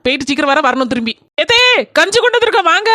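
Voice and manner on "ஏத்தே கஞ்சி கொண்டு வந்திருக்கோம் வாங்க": higher, louder call across the field. Acted. Daughter-in-law calling her mother-in-law over to eat, loud and high.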